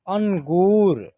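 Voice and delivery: अंगूर slowly, with a long held ू; drawn-out model reading. Shown as a demonstration. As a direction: stretch the second syllable of अंगूर. Teacher models the blended word.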